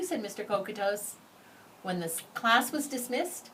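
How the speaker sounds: usual speaking voice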